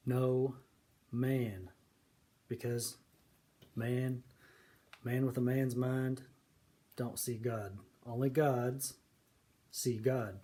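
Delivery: no, man, man, gods, each word set apart by pauses; spaced-out emphatic key words; emphasis on key words. Shown as a demonstration. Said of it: "I'm just gonna put some emphasis on it".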